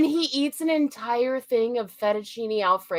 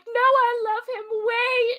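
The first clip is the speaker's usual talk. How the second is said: high-pitched imitated plea; hammed-up high lovesick protest; mock pleading; 11 semitones higher than usual. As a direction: plead high, as the character to police. Voicing woman pleading with police.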